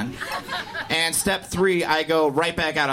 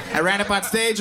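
ordinary talk, nothing marked

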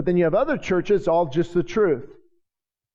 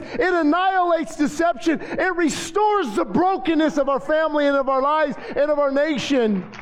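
much higher, ringing preacher's cry; fiery preaching; 10 semitones higher than usual. Acted. Pastor rousing the congregation about worship, fiery preaching. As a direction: preach it at full force, lifting the church. Preaching builds to full force.

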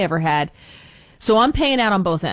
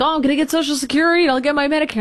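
higher and fast, mock naive optimism; mock cheerful self-quote, higher and quicker; mock hopeful; 7 semitones higher, faster than usual. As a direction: rattle it off like a gullible hopeful. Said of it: "and I'm like".

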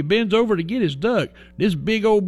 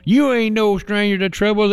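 ordinary delivery

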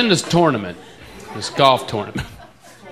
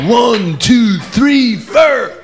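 loud rock-star countdown, higher and higher; 7 semitones higher, 4 dB louder, much slower than usual. Retold or imitated.

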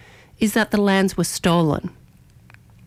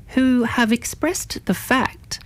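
fact said slow, higher and drawn out; stress on fact; 5 semitones higher, slower than usual. Shown as a demonstration. Radio host insisting on the fact, stressing fact. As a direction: lean hard on the word fact. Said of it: "and I stress the word fact".

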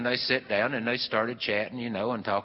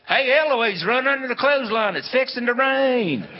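high, full-voiced playground holler; hammed-up shouted warning; yelling; 14 semitones higher, 10 dB louder than usual. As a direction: yell a warning across the schoolyard, drawing out the end. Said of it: "and somebody'd yell".

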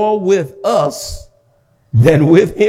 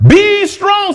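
emphatic shout; 11 semitones higher than usual. Real, not acted. Preacher rallying the congregation, shouting. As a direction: call it out like a rallying cry. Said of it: "Say, "Be strong".

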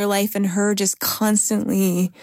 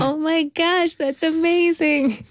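high, drawn-out awe; real amazement, pitched up and lingering; amazed; 8 semitones higher, slower than usual. Real, not acted. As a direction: marvel at it, high and stretched out. Moved by the mother's devotion.

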